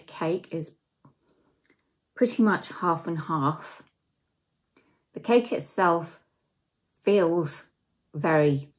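talking between bites; chewing while talking. Real, not acted. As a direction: mouth partly full of cake. Seen 0: Bites cake before; mouth closed, chewing during early words, then talks freely.

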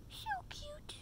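high soft whisper; whispered coo. Real, not acted. Fawning over the puppy, high soft whisper. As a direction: breathe it out adoringly.

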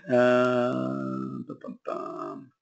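absent-minded murmured filler while searching; idle humming filler. Real, not acted. Coach scrolling through questions for the next one, idle humming.

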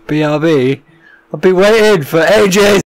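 high, loud frustrated yell; loud outburst of real annoyance; exasperated shout. Real, not acted. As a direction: bellow it in disbelief. Friend leaves after long wait.

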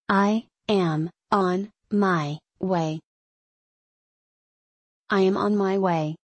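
normal talking voice

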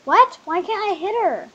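high, loud disbelieving cry; outraged disbelief. Real, not acted. Gamer failing to hit a hacker, high outraged cry. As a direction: protest at the unfair game.

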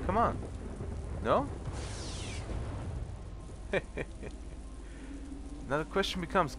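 usual speaking voice

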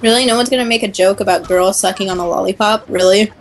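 usual speaking voice

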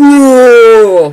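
loud high startled whoa; startled yell. Real, not acted. Yelling as a zombie grabs him, loud startled whoa. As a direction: jump and yell in fright.

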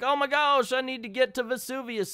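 high, hammed-up panic; a mock-frantic Scrooge; panicked Scrooge. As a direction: squawk it like a frantic old duck. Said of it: "who's in a panic".

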